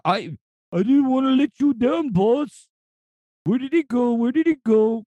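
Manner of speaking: high cartoon vulture voice; sorry vulture, pitched high. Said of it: "the impersonation".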